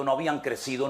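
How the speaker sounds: ordinary talk, nothing marked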